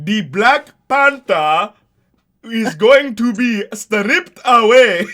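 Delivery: slow, over-enunciated loud mock African accent; mock Forest Whitaker accent. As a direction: crawl through each word, hammering every syllable. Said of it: "Did you hear Forrest Whitaker's accent".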